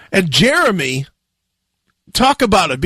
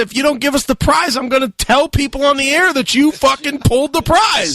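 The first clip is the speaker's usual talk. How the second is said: higher, fast, raised threat; defiant retold shout; 8 semitones higher than usual. Host retelling his threat to the manager, defiant shout. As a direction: fire back the ultimatum. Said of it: "I told him flat out".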